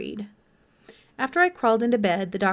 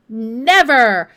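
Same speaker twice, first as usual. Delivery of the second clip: louder, roaring refusal; 2 semitones higher, 11 dB louder than usual. A narrator's reading. Senator flatly rejects feeding the beast surplus grain, roaring. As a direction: bellow it down like an outraged senator. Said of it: "Senator Granderson roared".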